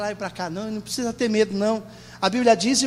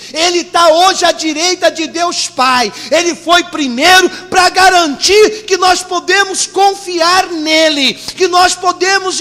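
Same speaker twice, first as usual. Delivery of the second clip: impassioned preacher, raised and sustained; full-force preaching; 6 semitones higher, 10 dB louder than usual. Real, not acted.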